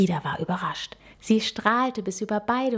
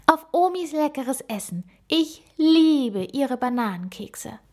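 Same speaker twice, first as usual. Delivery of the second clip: higher, fond voice savouring grandma's cookies; delighted little gorilla girl; 6 semitones higher, 3 dB louder than usual. A narrator's reading. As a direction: gush about grandma's banana cookies. Frieda talks of grandma.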